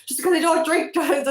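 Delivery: triggered comeback, broken off mid-word; indignant retort. Retold or imitated. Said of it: "That's the way I said it".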